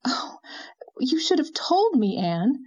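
high-pitched, impassioned reproach; passionate cry. A narrator's reading. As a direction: cry out in heartfelt protest. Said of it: "Leslie cried passionately".

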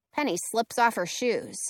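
ordinary delivery

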